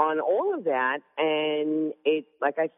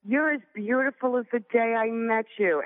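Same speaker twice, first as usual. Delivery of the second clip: higher, lightly voiced doting husband; imitating husband; 7 semitones higher than usual. Wife lightly mimicking her husband's old compliment. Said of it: "He used to say to me".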